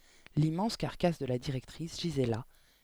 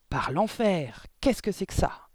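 loud, higher outraged cry; yelled; 4 semitones higher, 6 dB louder, slower than usual. A narrator's reading. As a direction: bellow it in shock. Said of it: "hurla mademoiselle Le Gourdin".

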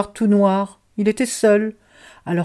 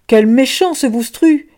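put-on louder, higher child's complaint; a child grumbling, raised and high; upset child; 5 semitones higher, 6 dB louder than usual. Child protesting to the others about Boustru, louder and higher. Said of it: "dit un des enfants".

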